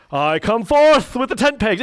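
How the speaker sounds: grand proclamation. Pretend. Tent-peg carrier answering Moses, mock grand and slow. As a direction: announce it like a herald, slowly. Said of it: "He's like".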